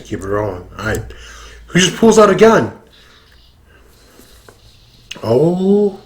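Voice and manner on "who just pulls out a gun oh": high, fast disbelieving yelp; surprised. Real, not acted. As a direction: blurt it out in shock. Shocked reaction to gun.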